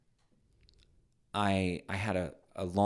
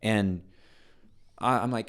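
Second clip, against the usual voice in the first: ordinary delivery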